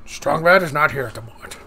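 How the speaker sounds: lightly voiced cartoon tough guy; Strong Bad voice. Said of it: "I can't do my strong bad voice very good".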